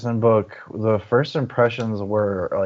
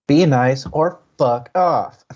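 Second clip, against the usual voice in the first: slow, punchy, louder send-off; blunt warning; 4 semitones higher, 4 dB louder, slower than usual. Real, not acted. Host telling chat to be nice, slow and blunt. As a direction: lay down the rule with a grin.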